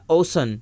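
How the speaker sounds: اوسن with an S in the middle; wrong S reading. Shown as a demonstration. Teacher shows how ocean is not read, S sound. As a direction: say اوسن as OH-sun. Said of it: "نہیں پڑھتے".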